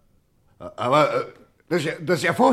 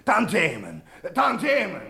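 each repeat climbs higher, the last a high soft sigh; dreamy greed building; 3 semitones higher, 3 dB quieter, slower than usual. Acted. Gang boss dreaming of endless royalties, rising and fading dreamily. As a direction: float off dreaming of money.

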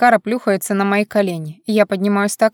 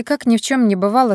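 plain reading voice

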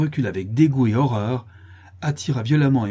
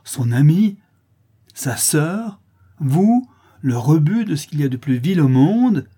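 raised, higher voice building in outrage; indignant denunciation; 5 semitones higher, 5 dB louder than usual. A narrator's reading. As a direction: hurl scornful questions at the culprit. Mother denounces Marie before everyone. (réquisitoire indigné, de plus en plus véhément)

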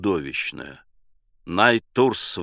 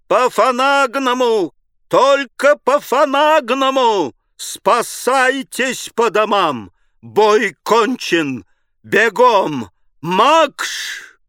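high, loud, slow burred bellow; cavalry-trumpet howl; 11 semitones higher, 5 dB louder, much slower than usual. A narrator's reading. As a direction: blare it like a bugle, r burred. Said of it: "Найтурс провыл, как кавалерийская труба". (как кавалерийская труба)

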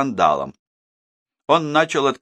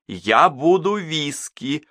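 slow, high, insisting on «буду»; stubborn repeat; 7 semitones higher, slower than usual. A narrator's reading. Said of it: "упрямо повторила Мадлен, повышая голос". (упрямо, медленно, с нажимом на «буду»)

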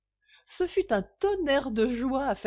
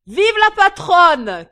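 slow, much higher, loud toast; cheering; 9 semitones higher, 16 dB louder, slower than usual. A narrator's reading. Drunk father toasting his wife, shouting. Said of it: "clama J B".

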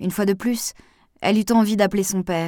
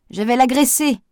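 loud, higher cry; shouted; 7 semitones higher, 5 dB louder than usual. A narrator's reading. Gardener shouts as he leaves, loud and high. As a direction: fling it back over his shoulder. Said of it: "cria-t-il".

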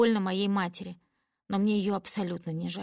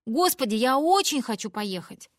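eager plea; 4 semitones higher, 6 dB louder than usual. Acted. Begging to go to Paris.

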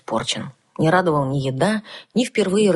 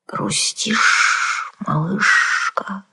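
sympathetic hissed whisper; hissing dragon whisper; 4 semitones higher, much slower than usual. A narrator's reading. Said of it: "сочувственно прошипела за спиной".

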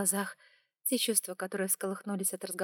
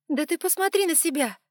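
high, loud outburst; exclaiming; 9 semitones higher, 8 dB louder than usual. A narrator's reading. Heroine exclaims at the unmoved dragon, high and loud. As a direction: throw it at him in disbelief. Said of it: "воскликнула я".

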